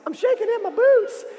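very high, squeaky mock-scared voice; scared Israelites. Shown as a demonstration. Storyteller teaches kids the Israelites' line, mock terror. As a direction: quail in a comic falsetto. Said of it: "they were so scared".

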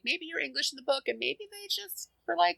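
mock wondering. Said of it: "so I was like".

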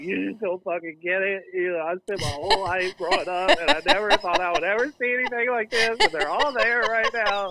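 put-on emotional fanboy near-shout about his childhood dream; worked-up outburst. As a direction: pour it out like a fan who finally got his moment. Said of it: "And I was like".

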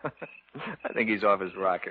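chuckling dismissively; slow, amused chuckle; amused laugh. Real, not acted.

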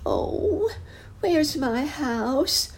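put-on very high, slow plaintive child's voice; a small boy calling out, lost; high drawn-out child's plea. A narrator's reading. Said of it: "said the boy over and over again".

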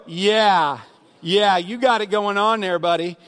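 loud, sarcastic put-on voice with a wink; mock praise, laying the sarcasm on thick. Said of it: "I'm gonna be sarcastic about it".

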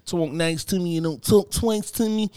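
high, slow singsong warning; imitating girlfriend's sassy wake-up demand; mock sassy partner. Mocking partner's morning demand.

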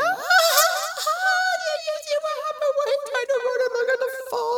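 high, fast gleeful taunting; mocking crowd. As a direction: blurt it out laughing at him.

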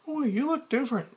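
high, fast woman's voice; Claire. Acted. Claire noticing Frank's change, in a high voice.

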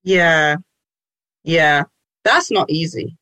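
normal talking voice

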